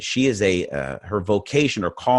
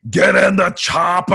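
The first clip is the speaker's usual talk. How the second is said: loud, slow, much higher shouted action-hero bark; bad Arnold Schwarzenegger; 10 semitones higher, 7 dB louder, slower than usual. Shown as a demonstration. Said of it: "Here's my bad Arnold Schwarzenegger".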